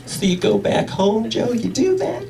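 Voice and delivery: lightly voiced imaginary questioner; a faint off-stage voice prodding Joe; imaginary interviewer voice, barely changed. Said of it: "imaginary voice asking me".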